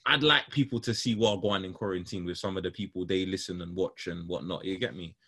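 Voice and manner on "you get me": ordinary delivery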